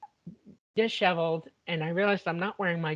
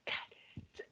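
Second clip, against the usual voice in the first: breathless whisper, cowed; whispered aside; 15 dB quieter than usual. Retold or imitated. After mom yells at her, a cowed whisper. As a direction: whisper it under your breath.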